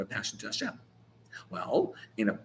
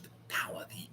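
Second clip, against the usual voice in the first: sudden whisper; 16 dB quieter than usual. Real, not acted. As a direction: drop to a breathy whisper on the power. Whispered dramatic aside.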